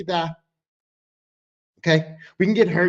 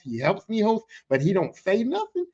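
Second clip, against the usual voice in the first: usual speaking voice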